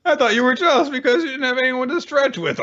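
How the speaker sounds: very high, strained comic voice; high-pitched acted exasperation; hammed-up indignant outburst. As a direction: squeal the accusation in mock outrage. Acted blurting at him.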